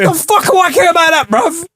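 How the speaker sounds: high-pitched mock disgust; mock outrage. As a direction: scoff at the calendar entry. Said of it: "And you're like".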